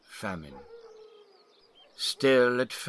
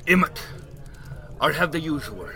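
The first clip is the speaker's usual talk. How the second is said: put-on old Irish drunk; 7 semitones higher than usual. Mickey's voice.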